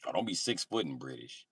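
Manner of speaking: ordinary talk, nothing marked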